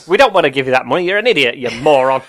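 loud mocking rant at a contestant; mock producer rant. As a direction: bark it out as a fed-up TV producer. Producers voiced insulting contestant.

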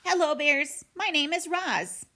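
high, courteous robot greeting; friendly robot. A narrator's reading. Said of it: "the robot's friendliest voice".

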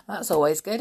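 words through a chuckle; laughing. Real, not acted. Laughing with children after the story, chuckling. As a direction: chuckle at the lazy joke.

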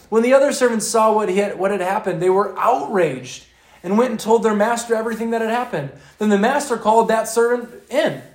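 loud, rising preacher's narration; fervent preaching. Acted. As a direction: drive the story home to the congregation. Preaching the parable at full force.

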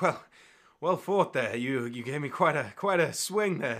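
panting between words; out of breath. Acted. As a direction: speak like you just fought a duel. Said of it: "you see he's kind of out of breath".

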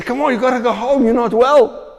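high, loud anxious urging; worried pleading. Retold or imitated. Bystanders begging the fainting man to go home, high and loud. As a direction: beg him to go home.

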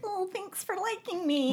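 high, small grateful voice; pathetically thankful, in a high little voice; meekly grateful. Retold or imitated. Acts out her grateful, insecure younger self.

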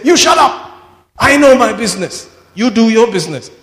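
high, loud retort easing at the end; angry shout. Retold or imitated. Wigglesworth telling the crowd to shut up, loud and angry. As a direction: shut them down hard.

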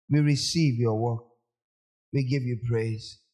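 soft, fading prayer, fast at the start; closing prayer. Acted.

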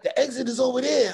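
high-pitched imitation of an excited wife. Wife pointing husband to the exit, mock excited. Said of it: "she's all excited".

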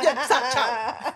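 imitating rushed speech; mock fast talk. Mocking friends by aping their rushed talk. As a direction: rattle it off to mock them. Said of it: "Así hablan ustedes".

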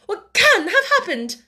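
high, distressed wail; tearful sniffing. A narrator's reading. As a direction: ask it as if crying. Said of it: "she sniffed".

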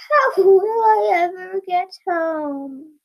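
high, slow mock wail; drawn-out storybook sob; sobbing. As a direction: wail it out, hammed-up and tearful. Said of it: "she sobbed".

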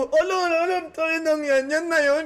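high-pitched retold jeering; mock taunting. Re-enacting his taunts at the horror-house ghosts, high mock jeer. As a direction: goad them, pitched well up. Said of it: "Ginaganong-ganong ko".

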